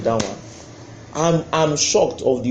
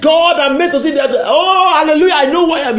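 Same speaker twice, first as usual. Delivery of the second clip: shouted high-pitched preaching; full-force preaching; 13 semitones higher, 8 dB louder than usual. Acted. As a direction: preach it out loud to the crowd.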